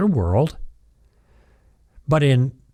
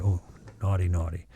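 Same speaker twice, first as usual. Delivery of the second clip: mock scolding; 6 semitones lower, 8 dB quieter, faster than usual. Host teases about "the S word.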